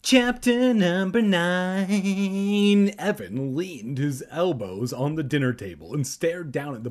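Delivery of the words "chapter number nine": slow, loud drawn-out announcement; dramatic announcer. Acted. Narrator announcing the chapter like an announcer. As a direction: boom it like a trailer voice.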